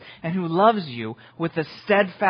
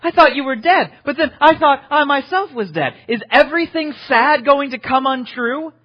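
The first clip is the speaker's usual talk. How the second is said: much higher, louder, wondering hobbit voice; Sam waking up; 10 semitones higher, 7 dB louder than usual. Retold or imitated. Pastor voicing Sam to Gandalf, high and amazed. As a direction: cry it out in amazed disbelief. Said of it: "And to this, Sam goes".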